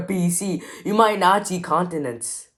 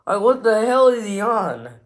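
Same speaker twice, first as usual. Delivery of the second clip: raised, drawn-out disbelieving question; baffled exasperation; 4 semitones higher, 4 dB louder than usual. Real, not acted. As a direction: demand it, utterly baffled. Baffled outburst at robot claim.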